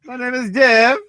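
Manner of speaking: high, loud, put-on voice for "My name is Dev"; mock introduction. Quoting the show to the co-host, a high loud put-on intro. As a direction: announce the name in a silly voice.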